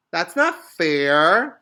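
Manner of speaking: very loud, slow complaint; girl objecting at the lunch line; loud protest. A narrator's reading. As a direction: call out the unfairness.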